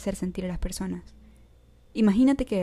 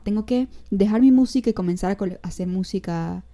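ordinary talk, nothing marked

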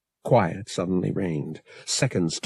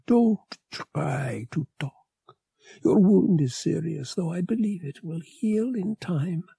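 higher, strange-timbred female alien voice; tender alien mate; 10 semitones higher than usual. Acted. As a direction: speak to him with worried love.

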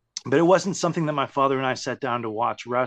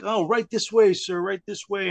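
bright, eager carnival barker welcome; ushering patrons in with a grin; chirpy old-fashioned bellhop; 7 semitones higher than usual. Retold or imitated. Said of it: "And he'd be like".

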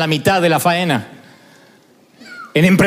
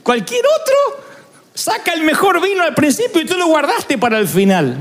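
amazed wedding guest; 8 semitones higher than usual. Retold or imitated. Guests praising [name] at the wedding, amazed gushing voice. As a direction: gush like a guest praising the groom. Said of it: "la gente me decía como al novio".

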